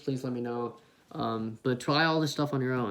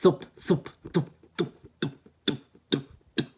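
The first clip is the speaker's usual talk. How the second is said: "thup" repeated, starting voiced then fading; thup kick drill. Shown as a demonstration. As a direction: turn the vowel down on each "thup". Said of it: "you could do like this".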